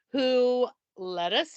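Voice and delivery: usual speaking voice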